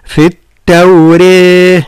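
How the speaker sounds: التوراة with the ra vowel leaned toward e; imala. Shown as a demonstration. Teacher showing the imala reading. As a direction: tilt the long a toward e in التوراة. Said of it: "وهناك وجه آخر وهو الإمالة".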